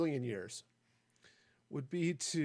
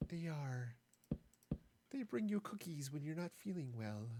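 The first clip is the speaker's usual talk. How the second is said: too nice to be trusted, softly; creepy friendly voice; 2 semitones higher, 6 dB quieter than usual. Pretend. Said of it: "it sounded super creepy in a nice and friendly voice".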